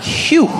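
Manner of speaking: high, loud whew of relief; exaggerated relieved whistle-like exhale; relieved phew. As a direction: let out a big high "phew". Acting relief at spotting help.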